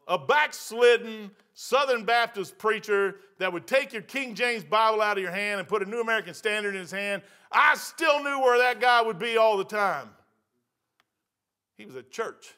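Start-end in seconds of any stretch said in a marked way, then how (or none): 0.1-10.1 preaching build-up. Acted. Preacher raising voice mid-sermon.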